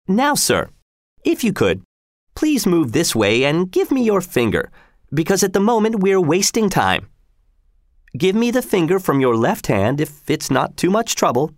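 high, politely insistent character voice; courteous executioner. A narrator's reading. Executioner asking Arnold for his finger, high and polite. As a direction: coax the victim with formal courtesy.

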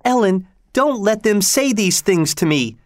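high-pitched, tearful plea. A narrator's reading. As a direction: plead high, close to tears. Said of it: "said with tears in her eyes".

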